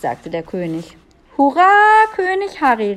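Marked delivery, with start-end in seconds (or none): 1.4-2.9 loud, higher-pitched cheer; calling out. A narrator's reading. Melissa cheers the new king, calling out loudly. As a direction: call it out as a jubilant cheer. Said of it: "rief Melissa".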